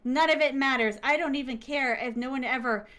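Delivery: normal talking voice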